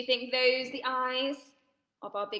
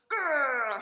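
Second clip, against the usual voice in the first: growl; 5 dB louder than usual. Shown as a demonstration. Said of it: "show me your muscles".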